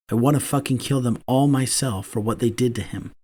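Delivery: plain reading voice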